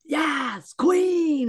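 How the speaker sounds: high, drawn-out whoop of acclaim; mock fan cheer. Guest imitating TikTok commenters, cheering loudly. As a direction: cheer it high and loud like gushing fans. Imitates gushing online fans.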